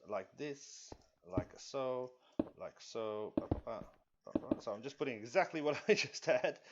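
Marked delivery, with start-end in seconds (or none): none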